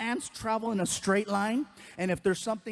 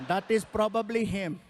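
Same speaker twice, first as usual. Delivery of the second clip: put-on Indian accent. Driver voiced deadpan.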